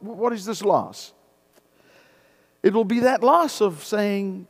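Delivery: usual speaking voice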